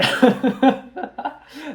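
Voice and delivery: loud high burst of laughter; real laugh. Real, not acted. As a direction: laugh out at yourself. Laughs at own patheticness.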